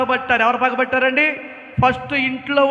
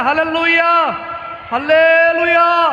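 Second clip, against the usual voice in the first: higher and louder hallelujah, drawn out; loud praise shout; 5 semitones higher, 5 dB louder, much slower than usual. Real, not acted. Pastor urging the church to shout praise, loud and high. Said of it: "చెప్ప గట్టిగా".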